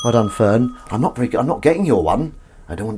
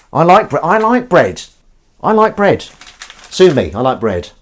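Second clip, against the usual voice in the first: mock defiance; 8 semitones higher, 8 dB louder than usual. Defiant bread declaration.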